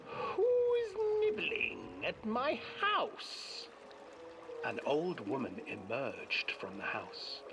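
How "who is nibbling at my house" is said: put-on high, slow old witch voice; sing-song, drawn out like a crone. Witch calls from her house.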